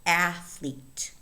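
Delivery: ATH-lete, first syllable of "athlete" prominent; stress on first syllable. Shown as a demonstration. Teacher pointing out stress in "athlete", first syllable stressed. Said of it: "The stress falls on the first syllable".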